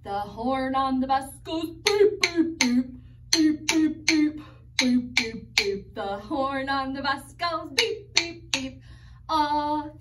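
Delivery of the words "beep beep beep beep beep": ordinary talk, nothing marked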